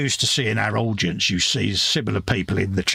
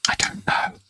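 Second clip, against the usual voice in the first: whispering. Real, not acted. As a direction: answer in a hushed whisper. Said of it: "Why are you whispering?".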